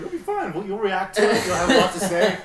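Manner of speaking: tag. Retold or imitated. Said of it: "I was like".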